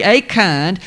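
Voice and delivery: usual speaking voice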